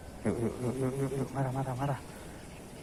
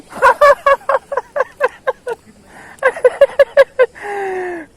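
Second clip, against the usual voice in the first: high, loud squeal of laughter; a loud, high gleeful laugh; 15 dB louder than usual. Real, not acted. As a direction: burst into a shrieking laugh. Laughing at the catch.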